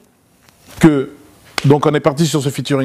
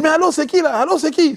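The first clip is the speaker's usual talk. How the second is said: confused caller; 12 semitones higher than usual. Retold or imitated. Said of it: "confused caller".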